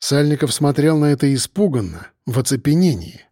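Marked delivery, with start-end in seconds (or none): none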